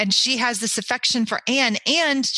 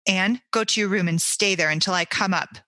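plain reading voice

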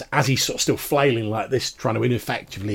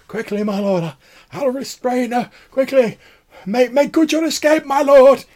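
high pleading cries, building louder; frantic old man shouting; 9 dB louder than usual. Acted. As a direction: yell desperately for his lord to flee. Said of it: "carries on shouting at the top of his voice".

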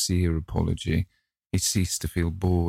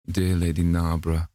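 ordinary delivery

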